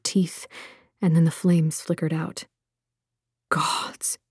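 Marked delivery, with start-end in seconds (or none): 3.5-4.2 breathless, unvoiced whisper; a man behind them whispers in shock. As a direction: gasp it out in awe. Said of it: "one of the men whispered".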